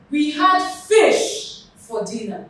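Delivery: slow down and hit "fish" hard; emphatic stress on "fish", the rest lighter. Shown as a demonstration. Teacher answering what was eaten, emphatic stress on "fish". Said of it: "I have stressed the word fish".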